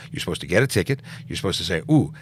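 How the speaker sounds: ordinary talk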